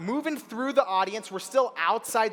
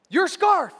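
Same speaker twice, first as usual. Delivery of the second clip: high, loud outburst; sudden high-pitched exclamation; loud blurt; 12 semitones higher, 10 dB louder than usual. Retold or imitated. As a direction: blurt it out as a desperate shout.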